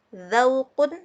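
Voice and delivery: Zaukun said loud with a clear z; teacher pronouncing Zaukun; example word. Shown as a demonstration. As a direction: model Zaukun for students. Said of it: "Ini contoh, contoh dari huruf".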